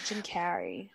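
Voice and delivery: soft "Jim Carrey" in an American accent; murmured American take on "Carrey". Shown as a demonstration. Co-host still testing the name, American accent. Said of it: "Now say Carrey in an American accent".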